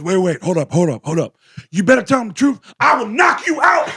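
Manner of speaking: high, heated mock threat from a guy on camera; imitated thug warning the woman in front of the cop; threatening tough guy. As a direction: play the hothead squaring up at her.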